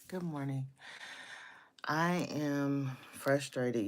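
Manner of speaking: normal talking voice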